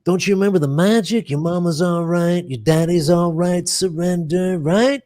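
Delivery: cool ticket-scalper voice, half sung; Damone. Acted. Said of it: "he talks in this cool voice".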